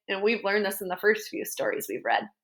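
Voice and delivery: usual speaking voice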